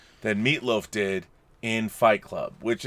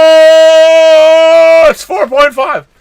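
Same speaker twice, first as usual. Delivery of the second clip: high, loud shaky quake voice; hammed-up earthquake guy; quake announcer; 14 semitones higher, 14 dB louder, much slower than usual. Host asks for his Kurt Quake, loud quaking cry. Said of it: "can you do your Kurt Quake?".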